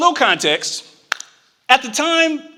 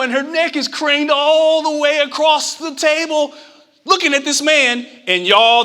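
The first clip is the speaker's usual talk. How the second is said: usual speaking voice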